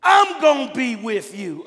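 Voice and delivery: loud, high booming promise; a shouted divine promise; God proclaiming. Acted. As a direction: declare it as God, full voice. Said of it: "The Lord looked at Gideon and said".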